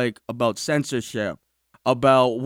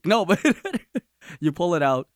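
ordinary talk, nothing marked

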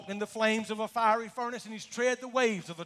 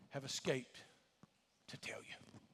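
fading to a whisper; 7 semitones lower, 17 dB quieter than usual. Acted. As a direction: drop lower until the last words are whispered. Messenger's words fading out.

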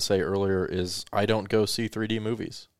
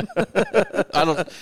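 laughing out the words; laughing through I don't; 5 dB louder than usual. Real, not acted. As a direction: crack up mid-sentence. Laughing at a joke.

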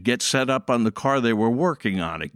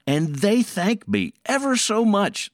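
ordinary delivery

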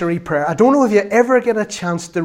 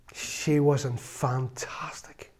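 hushed, breathless whisper of awe; awed whisper; 2 semitones lower, 9 dB quieter, much slower than usual. Real, not acted. Remembering praying Martha, whispering in awe. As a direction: breathe it out in wonder.

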